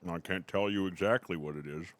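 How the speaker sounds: talking with a mouthful of brisket; chewing brisket. Real, not acted. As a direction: speak through a bite of food. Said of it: "Sorry, I'm eating brisket".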